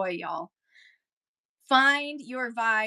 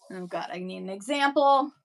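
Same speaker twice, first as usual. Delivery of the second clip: normal talking voice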